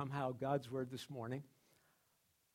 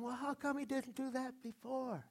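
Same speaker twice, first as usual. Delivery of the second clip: much higher, puzzled question; mimicking amazed onlookers who wonder why the dog changed; baffled onlookers; 11 semitones higher than usual. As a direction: ask it like someone who can't believe what they just saw. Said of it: "everybody's saying".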